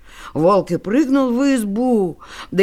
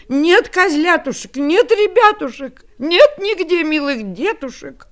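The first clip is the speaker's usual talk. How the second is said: higher, louder grieving mother; anguished lament; 7 semitones higher, 5 dB louder than usual. Acted. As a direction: cry out in despair for lost children. Goat finds children gone.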